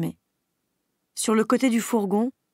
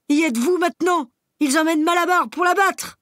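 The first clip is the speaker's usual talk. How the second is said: much higher, louder outraged cry; desperate shout; 8 semitones higher, 6 dB louder than usual. Acted. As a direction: hurl the truth at them. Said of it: "se mit à braire Benjamin". (Benjamin révèle l'horreur aux animaux en criant)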